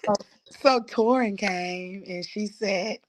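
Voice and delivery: ordinary delivery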